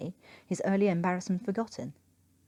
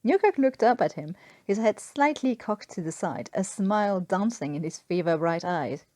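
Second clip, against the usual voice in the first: ordinary delivery, nothing marked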